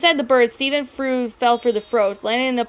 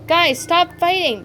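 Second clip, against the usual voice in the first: slow, higher, begging plea; pleading; 7 semitones higher than usual. A narrator's reading. Spinel begs two friends to stop fighting, slow pleading. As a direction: beg them to stop fighting. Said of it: "Spinel pleaded".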